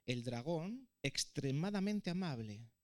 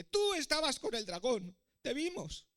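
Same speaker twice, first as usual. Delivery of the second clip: high, raised accusing cry; shouted accusation; 8 semitones higher, 5 dB louder than usual. A narrator's reading. Townspeople spotting the prince sneaking in, shouting accusingly. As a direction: call out the accusation. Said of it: "empezaron a gritarle".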